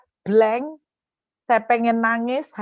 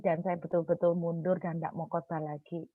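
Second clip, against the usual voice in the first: ordinary delivery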